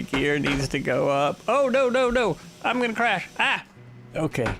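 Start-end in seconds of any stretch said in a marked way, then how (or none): 1.5-3.6 high panicked cry of no; near-crash panic, voice jumping up. Real, not acted. Gear not up, about to crash.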